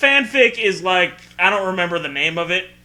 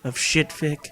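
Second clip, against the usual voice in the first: announcer intro; 5 semitones lower than usual. Acted.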